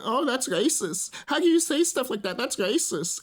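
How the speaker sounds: high-pitched mock-offended commenter; mock outrage. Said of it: "he was getting in the comment section".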